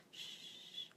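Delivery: tiny quiet shush, barely heard; the wrong gentle shush; weak quiet shush; soft shh. Shown as a demonstration. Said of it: "don't do the shh".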